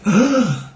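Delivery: high sharp mock gasp; a hammed-up horrified gasp; imitated shocked gasp. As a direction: act out the audience's shocked gasp. Shows audience reaction to heavy joke.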